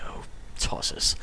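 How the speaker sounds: whispered under the breath; annoyed whisper. Real, not acted. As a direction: breathe out a frustrated curse. Muttered annoyance at a setback.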